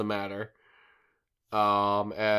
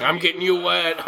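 playful water voice; 7 semitones higher, 8 dB louder than usual. Retold or imitated. Voicing the swamp water playfully.

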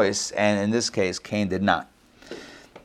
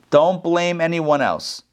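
ordinary talk

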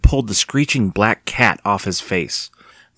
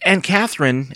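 raised-pitch hesitant appeal; pleading; 7 semitones higher than usual. A narrator's reading. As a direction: begin to plead with an aunt. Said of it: "Evan started to plead".

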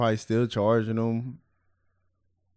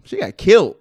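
loud, high shocked exclaim; 7 semitones higher, 6 dB louder than usual. Real, not acted. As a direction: blurt it out in shock. Shocked shout.

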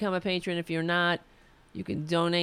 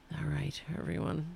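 hoarse, breathless sign-off with barely any voice; losing voice; 3 semitones lower, 8 dB quieter than usual. Real, not acted. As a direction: voice nearly gone, almost unvoiced. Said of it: "I'm losing my voice".

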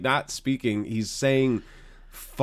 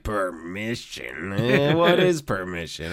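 "permission" drawn out slowly, rising, puzzled; mock shrug at rules; slow, mocking, laughing "Permission?"; 4 semitones higher, slower than usual. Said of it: "funniest way to deliver the word permission".

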